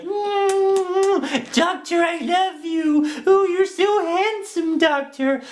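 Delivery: breathless, high, slow swooning admirer; swooning fan. Pretend. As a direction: coo at him like a lovestruck groupie.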